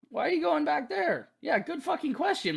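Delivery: high-pitched, indignant questioning; incredulous. Pretend. Player questions Alex going back there, incredulous. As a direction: push the pitch right up in disbelief.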